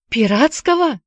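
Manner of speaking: high, startled question; shocked. Acted. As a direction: blurt it out in disbelief. Boy shocked to hear pirate.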